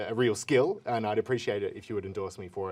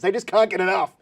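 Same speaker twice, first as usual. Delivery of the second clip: gleeful; 8 semitones higher, 8 dB louder than usual. Acted. Hyping up clickbait success.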